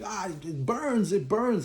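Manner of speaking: high, strained cry of burning pain, hammed up; mock pained yell. Husband runs out burning from the leaves, high mock pained cry. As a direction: yelp it like the skin is on fire. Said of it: "you hear screaming".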